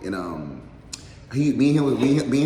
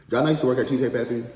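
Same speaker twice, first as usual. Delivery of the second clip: quick repeat; 5 semitones higher, 5 dB louder, much faster than usual. Real, not acted. Repeats earlier line faster.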